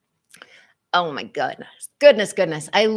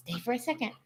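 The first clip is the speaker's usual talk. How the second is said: higher but much softer "Stay for a second"; soft repeat to dog; 6 semitones higher, 9 dB quieter, much faster than usual. Real, not acted. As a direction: hush it gently to the dog.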